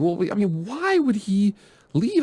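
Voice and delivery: asking in a high, put-on questioning voice; mock a puzzled fan asking why; imitating a doubtful fan. Host voices fans asking why the coach would leave, high mock question. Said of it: "a lot of people are asking".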